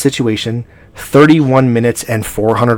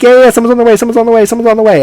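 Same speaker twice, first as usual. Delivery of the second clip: high, loud mimicry of a dismissive dispatcher; singsong mock reassurance, high and insistent; mocking brush-off; 12 semitones higher, 6 dB louder, faster than usual. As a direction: pitch it way up and parrot the empty promise.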